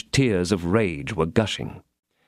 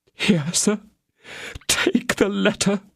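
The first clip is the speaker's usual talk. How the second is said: trembling, sobbing; 9 semitones higher, slower than usual. Acted. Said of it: "cried the Queen in a trembling, sobbing voice".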